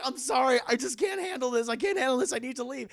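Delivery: high, loud, flustered apology; acted frazzled excuse; overwhelmed. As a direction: beg off in a mild panic.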